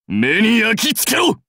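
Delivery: high, forceful warrior cry; battle shout. Acted. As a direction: rise into a fierce command.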